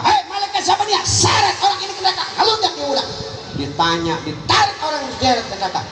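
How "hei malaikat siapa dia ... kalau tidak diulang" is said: much higher, loud fast command; angry angel shout. Retold or imitated. Furious angel orders him dragged to hell, shouting. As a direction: bark the order to drag him away. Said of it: "malaikat marah".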